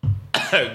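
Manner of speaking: dry chesty cough; real hacking cough. Real, not acted. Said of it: "Fucking bronchitis or something".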